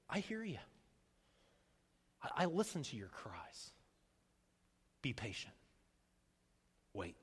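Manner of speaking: soft, spaced-out answer from God; hushed, patient comfort; gentle reassurance. Acted.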